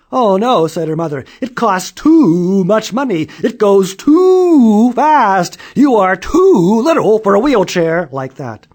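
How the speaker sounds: high, loud, slow protesting mother; dramatic mother. A narrator's reading. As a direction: overplay the shocked mom. Mother refusing.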